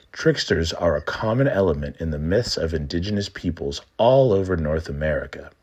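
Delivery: usual speaking voice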